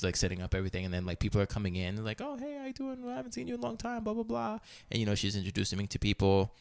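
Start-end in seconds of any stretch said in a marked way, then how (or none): 2.2-4.6 high, soft chatter imitating party guests; mock small talk. Guests greeting the host as they arrive, mock small talk. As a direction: breeze through imitated pleasantries in a small, high voice.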